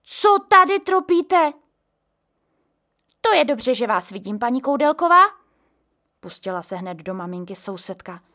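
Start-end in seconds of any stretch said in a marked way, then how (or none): 0.0-1.5 scolding. A narrator's reading. Mother scolds. 3.2-5.2 accusing. A narrator's reading. Neighbour lays into mother.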